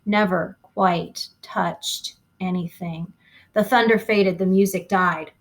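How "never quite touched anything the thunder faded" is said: plain reading voice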